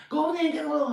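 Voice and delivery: curt order barked high and loud; mock boss barking an order; bossy boss. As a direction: bark it like a rude boss. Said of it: "that's how the boss would talk to me".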